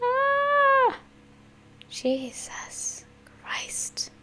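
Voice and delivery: stunned slow gasping whisper. Real, not acted. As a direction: gasp it out in shock. Stunned reaction to video.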